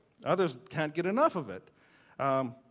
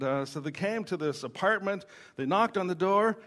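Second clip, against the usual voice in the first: usual speaking voice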